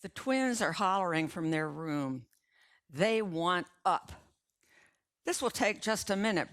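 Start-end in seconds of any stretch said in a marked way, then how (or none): 5.2-6.5 rushed and a bit higher, hurrying; real fast. A narrator's reading. Salesman hurries to keep the homeowner listening, fast. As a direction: get it out fast before she shuts the door. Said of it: "Brandon goes real fast".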